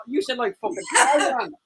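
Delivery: usual speaking voice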